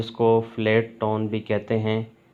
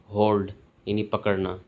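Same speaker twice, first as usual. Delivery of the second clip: ordinary talk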